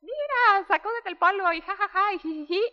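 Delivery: high, breezy brush-off with mock laughter; a lightly voiced carefree dad, ha ha. Voicing dad laughing off crying.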